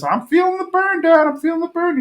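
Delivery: put-on very high squeaky kid voice; Bobby doing lunges for his dad, in a very high kid voice; straining kid. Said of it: "we see, uh, Bobby doing lunges".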